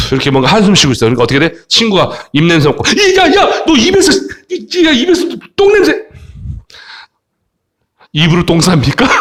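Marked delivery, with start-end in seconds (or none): 2.9-5.9 high, squealed comic revulsion; hammed-up disgusted squeal; mock disgust. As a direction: play a friend recoiling from a stink. Friend disgusted by bad breath, acted.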